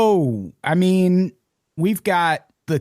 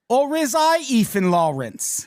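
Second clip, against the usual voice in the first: hammed-up British accent, pitched up; mock British accent; 8 semitones higher than usual. Host teasing his co-host, mock British accent. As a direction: fake London accent, teasing. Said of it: "'cause of me accent".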